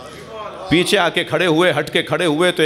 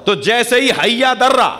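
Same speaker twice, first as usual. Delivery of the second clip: preaching at full force; 7 semitones higher, slower than usual. Acted. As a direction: drag out each Haider to the crowd. Preacher peaks on Haider naming. (پرجوش خطابت)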